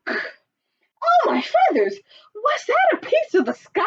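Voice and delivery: very high, squeaky frightened chick. A narrator's reading. Chicken Little squeaking in fright at the acorn. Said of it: "he squeaked".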